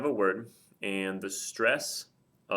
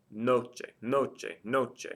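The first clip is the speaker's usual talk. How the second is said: noche repeated, each with stress on NO; 2 semitones higher, much slower than usual. Shown as a demonstration. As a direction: lean on the first syllable of noche. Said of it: "The stress is over the O on noche".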